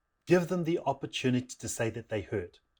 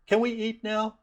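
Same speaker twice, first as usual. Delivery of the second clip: put-on high little-kid voice; squeaky, innocent kid question; youngest daughter; 10 semitones higher than usual. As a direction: imitate a child asking, pitched way up. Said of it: "it's the third daughter's turn".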